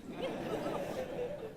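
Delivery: high breathy laugh. Real, not acted. Laugh after not cool.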